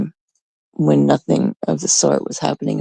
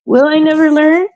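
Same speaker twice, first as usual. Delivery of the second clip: much higher, theatrical self-pity; mock lament; 11 semitones higher, 3 dB louder than usual. Real, not acted. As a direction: wail it like a drama queen.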